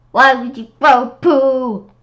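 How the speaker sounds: slow, loud, high disbelieving cry; incredulous outcry. Real, not acted. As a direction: react in disbelief at the idea. Incredulous outburst at story.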